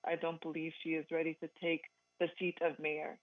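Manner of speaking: usual speaking voice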